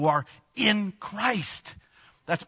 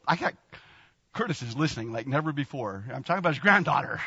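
normal talking voice

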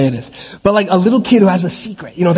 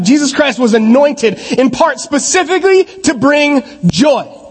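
preaching at full force; 9 semitones higher than usual. Acted. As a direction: lift the room up, almost shouting with joy. Preaching climax on joy.

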